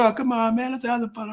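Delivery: hammed-up high-pitched pleading; mock yelling protest. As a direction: imitate rowdy guests protesting in a thin high cry. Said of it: "they're yelling and screaming".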